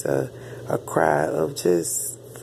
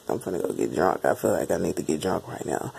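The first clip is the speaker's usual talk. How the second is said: low, soft mumbled repeat; much lower, muttered; 10 semitones lower, 5 dB quieter than usual. Retold or imitated. Same thought retold again.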